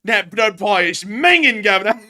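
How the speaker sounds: loud, high mock British voice; British accent. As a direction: belt out a cartoonish British guv'nor. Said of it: "The British come along saying".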